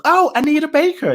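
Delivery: high-pitched "oh, now I get it"; mock sudden recognition. Listeners finally recognising the singer, imitated dawning recognition. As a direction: imitate someone finally catching on.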